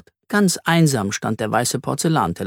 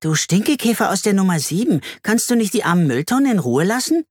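put-on child's voice; 7 semitones higher than usual. A narrator's reading. Children trading insults across balconies.

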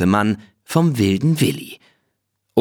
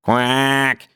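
a quick, high duck quack; nasal duck noise; 3 semitones higher than usual. Acted. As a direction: quack like a duck to mock him. Willi quacks at the sheikh.

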